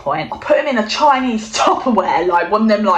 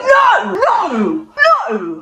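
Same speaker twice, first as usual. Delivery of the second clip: much higher, louder protest fading off; snatch it away with a frantic no; panicked no; 10 semitones higher, 4 dB louder, much slower than usual. Retold or imitated. Kit stopping foster mum from seeing the hamster, frantic yell. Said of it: "I'm like".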